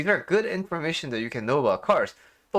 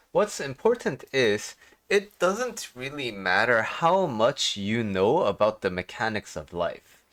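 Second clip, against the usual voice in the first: ordinary talk, nothing marked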